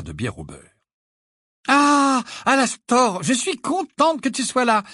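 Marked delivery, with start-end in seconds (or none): 1.7-4.8 loud, high, trumpeting welcome; ringing voice. A narrator's reading. Mother greeting an old friend on arrival, ringing voice. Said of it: "d'une voix claironnante".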